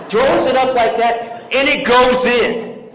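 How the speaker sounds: loud, high, drawn-out commentary; dramatic play-by-play. Retold or imitated. Speaker retelling a rival's basket to graduates, dramatic and loud. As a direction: stretch out the shot in agonized suspense. Dramatic climax of game story.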